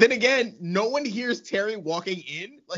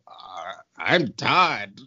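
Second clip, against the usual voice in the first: slow, hesitant, sheepish reply; mock awkward Todd; 6 semitones lower, 3 dB louder, much slower than usual. Host imitating Todd answering his girlfriend, sheepish and slow. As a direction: drag out the admission like a dopey boy. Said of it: "He's like".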